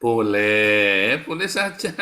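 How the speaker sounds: mock indulgent permission; sarcastic allowance. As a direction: grant it with a sneer.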